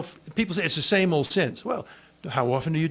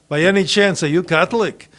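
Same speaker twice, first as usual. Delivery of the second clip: louder, pointed question; exasperated question; 8 dB louder than usual. Retold or imitated. Therapist finally losing patience with patient, loud pointed question. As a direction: ask it like you've had enough. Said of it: "he was exasperated and said".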